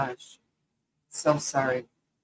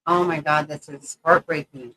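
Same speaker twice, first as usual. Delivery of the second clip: ordinary talk, nothing marked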